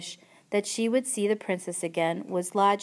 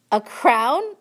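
loud, high mocking question; laughing incredulity; 6 semitones higher, 8 dB louder than usual. A narrator's reading. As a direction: scoff at the idea, voice raised. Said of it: "Uncle laughed".

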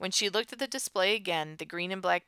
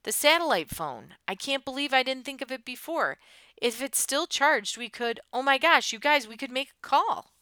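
higher, louder, with dawning hope; excited realization; 8 semitones higher, 6 dB louder than usual. Acted. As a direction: burst out with a sudden brainwave. Character realizes they can call for help.

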